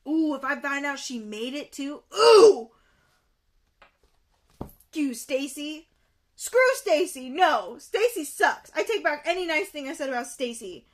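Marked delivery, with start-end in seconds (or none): 2.1-2.7 loud, high growing-mad exclamation; angry "ooh". Real, not acted. Angry at the friend. 6.4-8.7 loud, high indignant put-down; angry dismissal. Real, not acted. Angry at Stacy.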